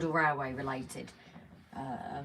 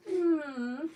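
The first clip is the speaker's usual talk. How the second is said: high cutesy hum, imitating a pleading look; a sweet little questioning hmm; 10 semitones higher than usual. Showing how Judy looked at her, cute high hum. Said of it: "she's looking at me so cute".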